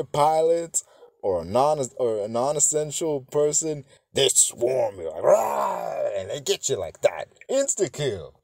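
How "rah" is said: high, sudden imitated roar; imitated swarming-zombie snarl. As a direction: pounce with a mock monster growl.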